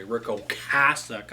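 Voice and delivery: [name] slowly as "oh-CASS-ick"; proper pronunciation. Shown as a demonstration. Record reviewer showing how to say it properly, [name] slowly as oh-CASS-ick. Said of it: "You gotta say it properly".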